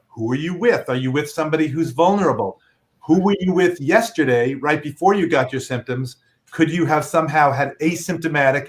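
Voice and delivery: ordinary delivery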